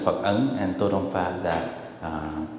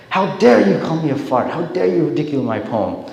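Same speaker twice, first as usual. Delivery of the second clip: furious; 5 semitones higher, 4 dB louder than usual. Retold or imitated. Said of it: "he was furious".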